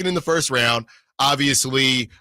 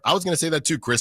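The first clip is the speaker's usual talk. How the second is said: usual speaking voice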